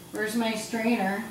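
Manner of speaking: usual speaking voice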